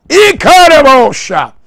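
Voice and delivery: high loud cry in tongues; shouted tongues. Real, not acted. Pastor shouting in tongues over the church. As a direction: hurl the tongue out at full voice.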